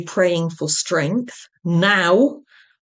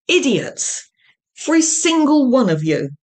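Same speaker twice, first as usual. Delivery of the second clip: angry yell; 6 semitones higher, 4 dB louder than usual. A narrator's reading. Varma berates colleagues.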